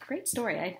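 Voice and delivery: normal talking voice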